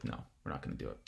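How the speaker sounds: very low, near-whispered quote; barely voiced, imitated aside; muted mock retraction. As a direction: drop to a hushed murmur, as if taking it back.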